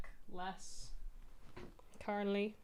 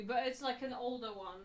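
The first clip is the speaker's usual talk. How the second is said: normal talking voice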